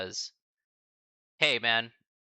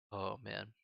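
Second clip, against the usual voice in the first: choked up; 4 dB quieter than usual. Real, not acted. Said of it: "promised I wouldn't get super emotional".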